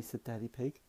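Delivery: ordinary delivery, nothing marked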